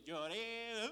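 loud drawn-out rising wail; imitated crying out. Retelling his breakdown before God, mock wailing cry. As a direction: wail the word, stretching it upward. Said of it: "ahí sí lloré".

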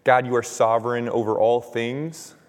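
ordinary talk

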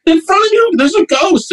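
pitched-way-up hysterical imitation; mock shriek. Roommate pointing out the ghost to his guest, mock shriek. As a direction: act out a frantic high shriek. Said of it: "he's like, shriek".

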